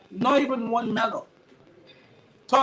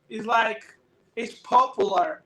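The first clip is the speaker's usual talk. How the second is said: ordinary talk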